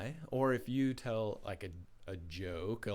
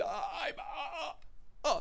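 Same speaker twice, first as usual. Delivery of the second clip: high, panicked stammer; too frightened to get the words out; freaking out; slower than usual. Acted. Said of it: "He freaked out".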